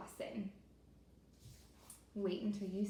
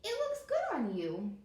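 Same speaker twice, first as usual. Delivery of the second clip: a lightly higher, kind turtle voice; second turtle; 6 semitones higher, 4 dB louder than usual. Acted. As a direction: answer kindly as the other turtle. Other turtle answers.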